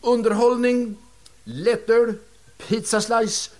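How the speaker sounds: high, loud billboard announcing; proud poster announcement. Retold or imitated. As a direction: call it out like a poster ad. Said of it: "där det stod".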